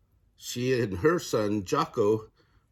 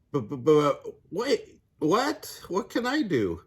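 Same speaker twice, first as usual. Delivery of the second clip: flustered crocodile; 6 semitones higher than usual. Acted. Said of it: "began to cry too".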